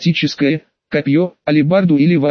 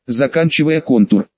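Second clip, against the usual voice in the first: ordinary delivery, nothing marked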